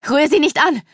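scream. A narrator's reading. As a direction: yell to protect the child. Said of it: "schrie Sedonia".

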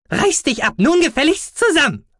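high, squeaky scolding; indignant squeaky yell; calling out. Acted. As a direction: scold him loudly as a little creature. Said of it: "rief er".

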